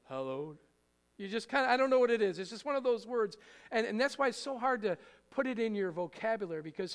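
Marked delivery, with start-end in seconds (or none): 0.1-0.6 low, hushed "Hallowed." with a lift at the end; a hint of church-voice awe on "Hallowed."; reverent tone. Shown as a demonstration. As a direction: drop into a low solemn voice for "Hallowed.". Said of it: "say it with a different kind of tone in your voice".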